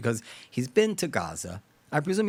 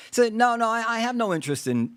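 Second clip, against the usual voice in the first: lightly voiced pundit brushing off a question, higher; imagined dismissive commentator; 8 semitones higher than usual. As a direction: wave the question away in a raised voice. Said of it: "say to the Chinese guy".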